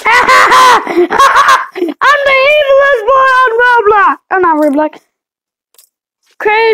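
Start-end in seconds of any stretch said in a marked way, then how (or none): 0.1-4.2 evil laughing gloat. Acted. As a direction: cackle it like a villain.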